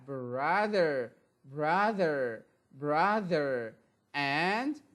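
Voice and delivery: slow, drawn-out "grandfather" and "grandmother"; slow teacher drill. Shown as a demonstration. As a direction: stretch each word for the class to copy. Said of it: "we pronounce it T H".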